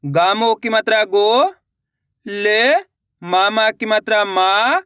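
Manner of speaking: slow spelling-out, stretching गो and मा upward; syllable-by-syllable reading. Shown as a demonstration. Teacher showing learners how each letter is pronounced, drawn out. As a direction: separate each syllable with a pause. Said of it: "एक अक्षर का उच्चारण करके".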